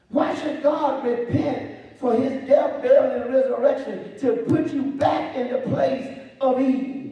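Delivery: loud, high, rising preacher questions; preaching. Acted. Preacher hammering questions at the congregation, high and loud. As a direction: hammer each why up high.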